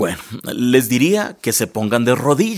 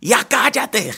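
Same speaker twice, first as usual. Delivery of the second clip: retold yell; 11 semitones higher, slower than usual. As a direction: re-enact losing your temper at a child. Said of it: "le grité".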